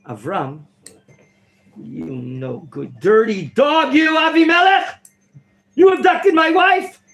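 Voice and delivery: the wronged husband exploding at the king; acted outrage. Avimelech accused, from slow scorn to a furious yell. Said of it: "but I got a little excited".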